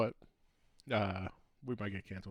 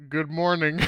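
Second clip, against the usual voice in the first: loud, higher, blurted good morning; awkward blurted greeting; 6 semitones higher, 10 dB louder than usual. Retold or imitated. To a startled older lady in the dark, blurting a loud greeting. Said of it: "And I said".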